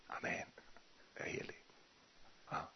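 whispered reply to God, very soft; hushed answer. Retold or imitated. Preacher answering God, whispered and very soft. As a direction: breathe the answer almost silently.

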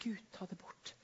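soft whispered prayer; hushed whisper to God; whispered plea. Retold or imitated. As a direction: breathe the plea under your breath. Repeats plea sitting up in bed.

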